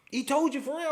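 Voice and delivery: high, loud incredulous question; acted disbelief. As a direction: squeal it in comic astonishment. Acted coworker amazed at the news.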